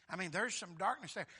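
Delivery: normal talking voice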